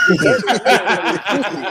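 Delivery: slow, loud villain voice cracking into laughter; mustache twirling villain. Retold or imitated. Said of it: "twirled his mustache and said".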